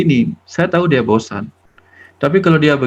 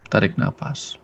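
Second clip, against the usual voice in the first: trailing off quietly; softer breath cue; 3 semitones lower, 7 dB quieter than usual. Shown as a demonstration. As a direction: repeat "tarik nafas" softer, almost fading. ("tarik nafas" lebih pelan dan lirih)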